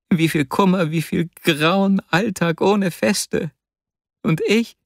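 high, impassioned and building; overwhelmed outpouring. A narrator's reading. Vasya marvels to his friend at being loved, overwhelmed.

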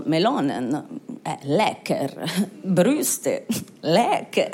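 low, dazed musing; mock Italian voter. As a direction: ponder each word in a low, halting voice. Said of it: "die Italiener waren so".

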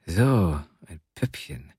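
low voice dropping very soft; coaxing, trailing away softly; coaxing older man. A narrator's reading. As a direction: muse softly to the child. Man coaxes the little girl.

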